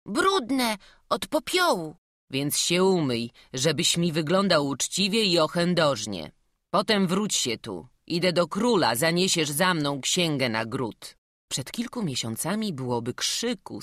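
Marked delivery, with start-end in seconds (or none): 0.1-2.0 put-on high, small child's voice; sheepish boy. Boy admits dirty hands. 2.3-11.2 put-on louder, firm old priest; stern canon giving orders.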